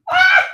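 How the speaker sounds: sudden loud, piercingly high scream. Real, not acted. Fan overwhelmed by a goal, high loud scream. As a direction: let out a short high shriek.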